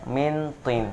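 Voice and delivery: min tin with a crisp clear n; wrong reading. Shown as a demonstration. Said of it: "itu yang salah". (mintin dibaca jelas tanpa dengung)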